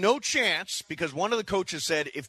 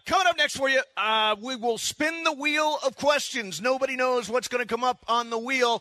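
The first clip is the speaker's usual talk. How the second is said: radio announcer; 7 semitones higher than usual. Acted. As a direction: sell the next segment like a DJ. Radio promo before the break.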